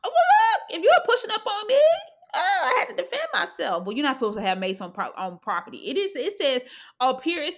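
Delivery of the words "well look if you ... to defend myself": real laugh breaking through, high and loud. Real, not acted. Defending herself to a coworker, laughing hard. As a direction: laugh it out while arguing the point. Cracking up mid-argument.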